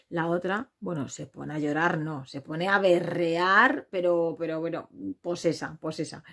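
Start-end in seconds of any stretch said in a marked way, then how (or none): none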